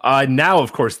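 usual speaking voice

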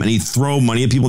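ordinary talk, nothing marked

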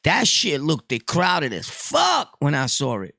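ordinary talk

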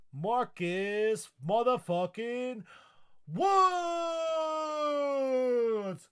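slow, high, loud announcer build; hype announcer. Acted. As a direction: announce him like a boxing champ. Hype announcing patron name.

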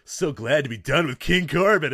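high, loud, slow mock wrestler promo; put-on backstage interview voice; Matt Riddle. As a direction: play a relieved wrestler, drawn out and hammy. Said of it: "Riddle backstage goes".

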